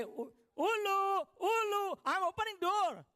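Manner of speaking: high, slow drawn-out call; sing-song wife. Retold or imitated. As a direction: call back sweetly from the door. Wife calls back from inside.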